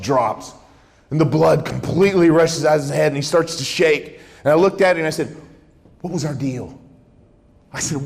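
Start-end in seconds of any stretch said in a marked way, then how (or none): 6.0-6.8 understated confronting question; retold quiet confrontation; measured challenge. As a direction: put the question evenly, holding back. Interrogator confronts caught liar.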